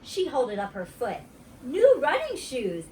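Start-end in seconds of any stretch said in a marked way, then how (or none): 1.6-2.9 high, slow, proud show-off; girl boasting about new shoes. Acted. Grace showing off shoes.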